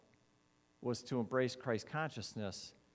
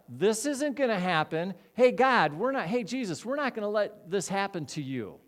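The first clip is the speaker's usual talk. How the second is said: higher, louder pleading denial; loud protest; 6 semitones higher, 7 dB louder than usual. Acted. Peter loudly refusing to let Jesus go. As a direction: protest like you won't allow it.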